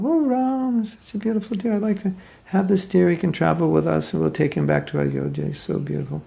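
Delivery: high, sweet coaxing voice of a wife charmed by a deer; pleading wife. Retold or imitated. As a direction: Sita begging her husband, pitched high and eager. Sita begs Ram for deer.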